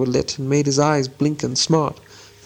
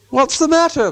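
high-pitched call across a distance; 13 semitones higher, 3 dB louder than usual. A narrator's reading. Bilbo to Gollum in the dark, calling out. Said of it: "Bilbo called".

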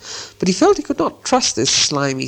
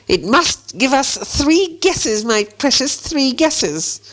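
high, slow, hissing creature voice; Gollum; 11 semitones higher, much slower than usual. A narrator's reading. Gollum demands three guesses from Bilbo, hissing. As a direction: demand it high, lingering on the esses. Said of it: "Hissed Gollum".

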